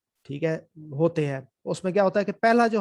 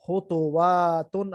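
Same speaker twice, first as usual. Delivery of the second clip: خطواتن drawn out slowly; slow, clear teacher model of خطواتن; slow model reading; much slower than usual. Shown as a demonstration. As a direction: read خطواتن syllable by syllable. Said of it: "دو پیش ہے اس کے اوپر".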